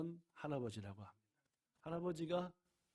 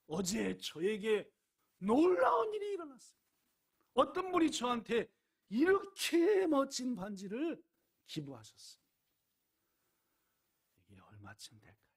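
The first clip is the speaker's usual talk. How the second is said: excited; 8 semitones higher, 8 dB louder than usual. Acted.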